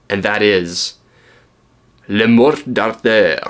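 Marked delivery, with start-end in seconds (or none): 2.1-3.4 slow, drawn-out French accent. Shown as a demonstration. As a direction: say the title in a homemade French accent. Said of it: "my French accent".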